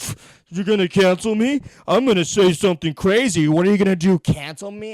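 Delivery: loud sneering mock bravado; mock edgy comic. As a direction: sneer it like a smug edgy comedian. Mocking edgy comedians.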